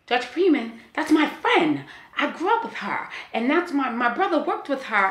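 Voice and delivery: acting out an elated lawyer, high-pitched and loud; excited lawyer. Lawyer telling his client the judge is his friend, excited. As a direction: imitate someone thrilled, voice jumping way up.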